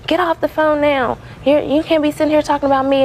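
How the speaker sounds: retelling how the manager yelled at her; mock angry yell. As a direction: play the furious manager, high and sharp. Manager yelling at employee, retold.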